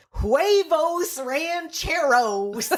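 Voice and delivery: slow, high, laughing Spanish order "Huevos rancheros."; drunk Spanish order. Retold or imitated. Drunk diner ordering from a Mexican waiter, slow laughing Spanish accent. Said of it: "I ordered from the Mexican waiter".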